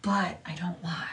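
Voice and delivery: breathy pointed whisper, slowed down. Real, not acted. Aside to viewers about the rival, whispered. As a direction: drop to a whisper for the jab.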